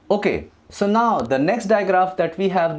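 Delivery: usual speaking voice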